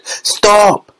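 high urgent "Stop!"; Wonka's alarm. A narrator's reading. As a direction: halt her at once. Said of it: "said Mister Wonka".

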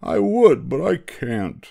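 high, pleading wail, lightly acted; mock sobbing. A narrator's reading. Thidwick answering his friends, half-sobbing. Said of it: "sobbed poor Thidwick".

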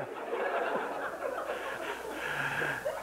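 soft airy laugh; breathless laugh. Real, not acted. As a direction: laugh under your breath. Laugh after the quote.

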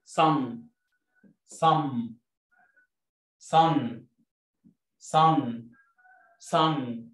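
صن repeated with a heavy emphatic ص. Shown as a demonstration. Teacher drilling kids on صن with heavy ص. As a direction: drill صن with a thick ص each time. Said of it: "زي حرف الصاد".

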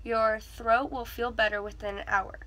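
ordinary talk, nothing marked

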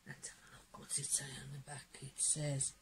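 hushed whisper to herself; quiet curious whisper. Real, not acted. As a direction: breathe it as she turns the card. Reading the card back.

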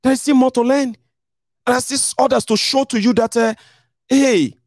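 high, patient mock lecture; speaker's own reply. Speaker answering the friend: high, teasing lecture. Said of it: "I say".

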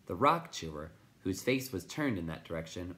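plain reading voice

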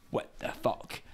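hushed, voiceless disbelief; retold stunned whisper. Partner reacts to the knifeman's greeting, stunned imitated whisper. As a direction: whisper it in mimicked shock.

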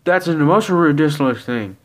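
loud, high scoffing protest; indignant outburst. Acted. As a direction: erupt in indignation. Indignant outburst in acted argument.

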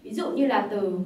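usual speaking voice

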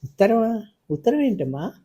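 a father hiding the letter, suddenly flustered. A narrator's reading. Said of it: "ఆయన కంగారు పడ్డారు". (కంగారుగా, ఉలిక్కిపడి)

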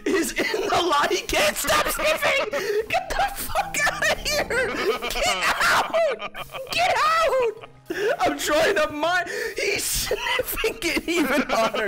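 high, loud exasperated shouting; frantic yelling. Real, not acted. Gamer yelling at a lava-sniffing mob, frantic shouting. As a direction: yell in shrieking exasperation.

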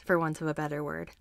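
usual speaking voice

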